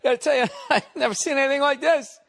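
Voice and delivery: high, loud, fast laughing quote; laughing contractor. Retold or imitated. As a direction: squeal it through laughter. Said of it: "the guy was laughing".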